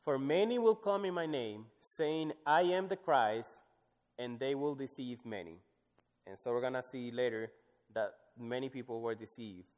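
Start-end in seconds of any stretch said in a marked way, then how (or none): 0.0-5.6 scripture reading. Acted. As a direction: proclaim the verse. Reads scripture aloud.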